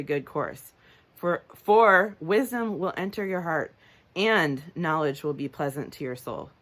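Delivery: THEN, FOR and AND punched in a verse reading; emphasis on conjunctions. Shown as a demonstration. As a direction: read scripture, hitting "for" and "and" harder. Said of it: "you'll hear me emphasize them".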